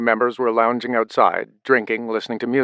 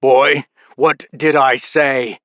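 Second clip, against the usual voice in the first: through gritted teeth; 3 semitones higher, 5 dB louder, much slower than usual. A narrator's reading. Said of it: "Through gritted teeth".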